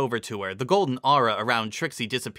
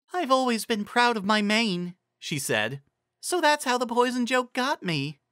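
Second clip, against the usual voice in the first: higher pitched mare calming herself; a mare steadying herself; lightly voiced pony mare; 11 semitones higher than usual. A narrator's reading. Carrot Top admits her pride in her mane, lightly voiced mare.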